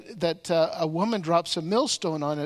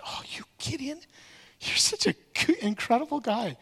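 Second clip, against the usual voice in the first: breathy whispered gushing, pitched higher; fawning flattery; 6 semitones higher than usual. Retold or imitated. Tribe leaders sweet-talking Gideon, mock gushing whisper. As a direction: coo it like a flatterer.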